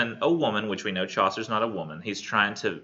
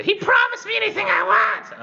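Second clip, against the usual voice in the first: very high, loud hag shriek; crass Cockney hag; 7 dB louder than usual. Acted. Hag claims her promise before the court, high shrieking Cockney. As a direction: shout it shrieking like an old crone. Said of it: "That's how she would talk".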